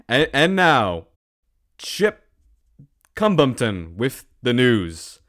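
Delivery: dramatic newsroom introduction; mock news announcer. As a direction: hand over like a TV host. Mock news host introduces anchor.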